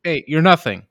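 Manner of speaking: hammed-up belittling, punched out; mock berating. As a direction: play at crushing them with it. Mock boss putting someone down.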